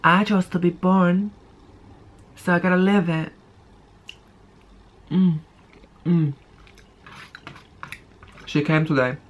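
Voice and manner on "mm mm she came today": chewing while talking; talking between bites; mouth full. Real, not acted. Seen 3: Fork of pasta enters mouth at -1; chewing visible through +4.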